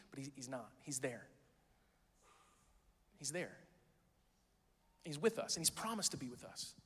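quiet reassurance. Real, not acted. Reassuring doubters God is present.

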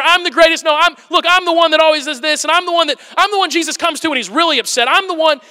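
high, loud boasting squabble; a heated, bragging yell, mock-acted; mock yelling disciple. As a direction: shout over each other for top spot. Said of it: "They're yelling at each other".

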